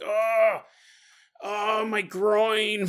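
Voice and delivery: slow, hammed-up moan of pain, half laughing; pained groan. Husband clutching his groin, hammed-up slow moan. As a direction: stretch it out like a comic agony. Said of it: "And he's like".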